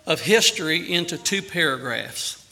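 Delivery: ordinary talk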